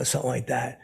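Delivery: ordinary talk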